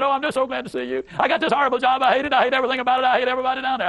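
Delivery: a lightly voiced negative Nell pouring out her grievances; complaining woman. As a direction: play the aggrieved worker dumping every complaint. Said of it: "you're talking about negative Nell".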